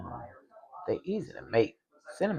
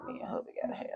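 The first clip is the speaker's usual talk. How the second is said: whisper; 8 dB quieter, much faster than usual. Real, not acted. Whispered aside to viewers.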